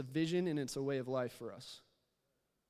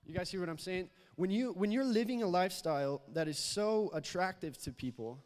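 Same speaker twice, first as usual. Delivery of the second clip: louder, pressing preaching voice; building sermon; 2 semitones higher, 5 dB louder than usual. Acted. Preacher urging the church to go out, louder preaching. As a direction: drive the point home to the congregation.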